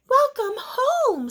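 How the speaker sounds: high, slow, loud welcome; cheerful mother. Acted. Mother welcoming her daughter home, high and cheerful. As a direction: greet her warmly and brightly.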